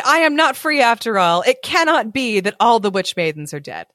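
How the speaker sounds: plain reading voice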